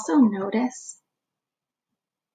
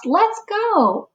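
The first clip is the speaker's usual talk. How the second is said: high, eager call of "Let's go!"; rally the search party; mock shout; 11 semitones higher than usual. Badger rallying friends, lightly acted shout. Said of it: "Badger lights a lamp and shouts".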